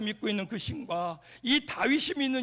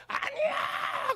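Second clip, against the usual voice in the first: "아니야!" pitched much higher and dragged out slowly, breathy yell; drawn-out shout; much slower than usual. Retold or imitated. Preacher re-enacting his stretched shout to the students. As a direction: stretch it all the way to the end. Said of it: "저 끝에까지 끌면서".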